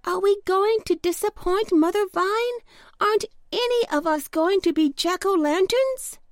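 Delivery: high, anxious storybook questioning; pumpkin crying out. A narrator's reading. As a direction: ask in a small high worried voice. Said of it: "he cried".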